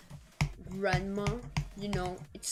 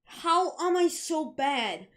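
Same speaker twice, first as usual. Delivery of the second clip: high, loud cry of frustration at the game; real frustrated yelp at a missed move; frustrated shout; 9 semitones higher, 8 dB louder, much slower than usual. Real, not acted. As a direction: yell at your own mistake. Gaming fail outburst.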